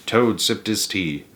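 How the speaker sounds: plain reading voice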